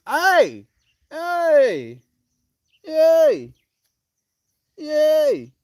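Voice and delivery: very high, loud cheering; excited cheer. Real, not acted. She whoops high and loud at her playful dog. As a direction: whoop with delight.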